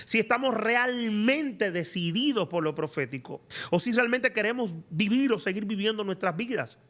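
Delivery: ordinary talk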